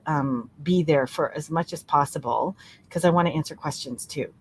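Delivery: ordinary delivery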